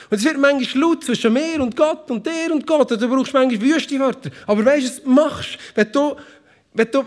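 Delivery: high, pressing sermon voice; fervent preaching. Acted. Pastor urging the church about fighting with God, fervent. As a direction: drive the point home to the congregation.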